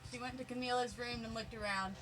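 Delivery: ordinary delivery, nothing marked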